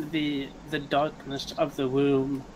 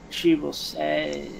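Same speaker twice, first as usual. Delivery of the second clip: ordinary talk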